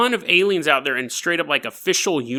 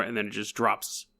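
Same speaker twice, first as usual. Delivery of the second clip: each phrase lower and softer; slowing down and fading; 5 semitones lower, 5 dB quieter than usual. Acted. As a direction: let the voice sink and fade away. Slow-motion bullet slowing down.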